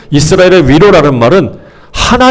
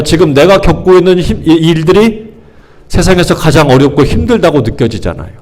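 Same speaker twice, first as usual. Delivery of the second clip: usual speaking voice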